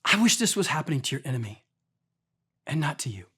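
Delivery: soft, low and sorrowful toward the king; Daniel gently breaks hard news to the king, softly; respectful. Retold or imitated. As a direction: gently soften the blow. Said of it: "Look at the respect in that".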